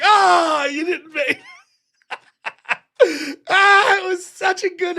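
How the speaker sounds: high, loud taunt breaking into laughter; laughing mock taunt. Rich villain jeering at the kid who missed, laughing. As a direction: crow at the kid while laughing.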